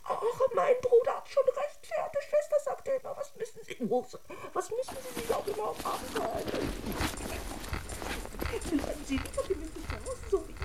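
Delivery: high, soft prissy voice of a fussy brother; prim brother. Acted. Doll imitates her brother's advice, high and prissy. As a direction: mince it out like a pompous sibling.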